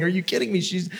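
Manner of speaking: high-pitched mock disbelief, no real laugh; imitating distraught people who can't believe it; incredulous mourners. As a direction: act out upset, scoffing disbelief. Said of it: "they just start laughing".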